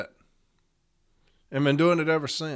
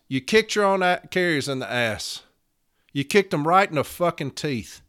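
ordinary talk, nothing marked

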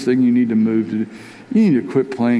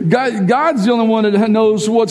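full-force preaching; 9 semitones higher than usual. Acted. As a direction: preach it, sweeping the church along. Preaching climax after the quote.

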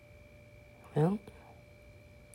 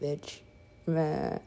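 ordinary delivery

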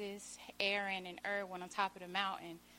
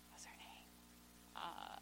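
whispering; 12 dB quieter than usual. Real, not acted. Whispered aside while forgetting a name.

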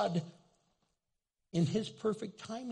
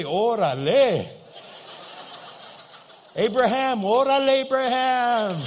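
louder, laughing cheer; loud laughing congratulation; delighted Órale shout; 4 semitones higher, 8 dB louder than usual. Pretend. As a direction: cheer the old man on. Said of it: "I'd say".

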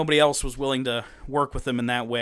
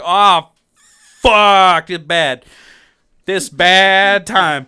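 loud, drawn-out cry of dismay; hammed-up, higher-pitched outburst; mock alarm; 4 semitones higher, 11 dB louder, much slower than usual. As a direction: holler it like everything just went wrong. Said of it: "and they're like".

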